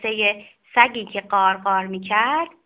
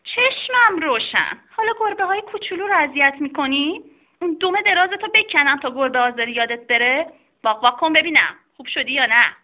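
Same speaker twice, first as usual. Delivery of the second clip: higher, fast mock-stern telling-off; scolding doctor; 3 semitones higher than usual. Doctor scolding the dog for bullying kittens, mock-stern. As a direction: wag a finger at the naughty dog. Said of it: "به آقا سگه گفت".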